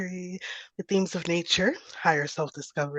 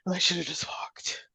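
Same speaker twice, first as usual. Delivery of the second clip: whispered; 5 dB quieter than usual. Real, not acted. Breathless after stairs.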